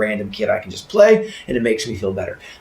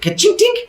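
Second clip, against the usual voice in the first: high, tinkly imitated click; lock clinking; 3 dB louder than usual. Woman undoing a little lock, high clinking noise. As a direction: make small metallic sounds with the voice.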